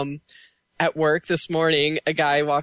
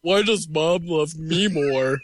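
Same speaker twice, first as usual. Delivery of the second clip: slow, lightly voiced jealous brother; Brad Garrett impression; slower than usual. Friend finishes the Brad Garrett bit, slow jealous brother voice. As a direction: whine it slowly like a jealous brother. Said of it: "Brad Garrett bit".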